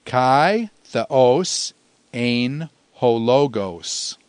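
slow, measured Greek for repeating along; say-along recitation. Shown as a demonstration. Teacher leading students through the verse in Greek, slowly recited. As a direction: recite word by word, leaving small gaps. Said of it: "say it with me".

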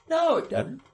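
high, scoffing put-down; sarcastic. Retold or imitated. King brushes off Paul's appeal, a high sarcastic scoff. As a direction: toss it off with mock disbelief. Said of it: "Hear the sarcasm?".

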